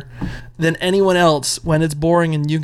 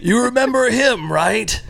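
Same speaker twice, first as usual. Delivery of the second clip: cheesy blurb voice; 7 semitones higher, slower than usual. Retold or imitated. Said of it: "I guarantee that one from Nightbreed is".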